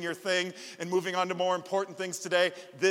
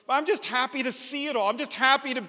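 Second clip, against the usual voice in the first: put-on higher and louder, beaming child voice; overjoyed kid; 8 semitones higher, 7 dB louder than usual. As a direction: sound like a boy too happy to sit still. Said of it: "He goes".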